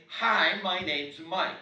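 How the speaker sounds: loud, exaggerated introduction; emphatic greeting. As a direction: belt out a friendly hello. Mock greeting a stranger should say.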